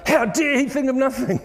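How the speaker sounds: high, laughing mock outrage; hammed-up wife's indignation through a laugh; outraged wife. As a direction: play a wife outraged her husband thinks of nothing.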